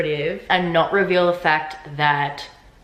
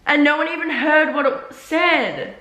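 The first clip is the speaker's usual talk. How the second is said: high, laughing exasperation; laughing disbelief; 9 semitones higher, slower than usual. Real, not acted. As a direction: laugh it off in real annoyance. Laughing at the dumb plot.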